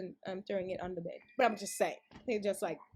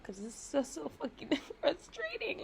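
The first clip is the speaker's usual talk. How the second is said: frustrated laugh; 5 semitones higher, 4 dB quieter than usual. Real, not acted.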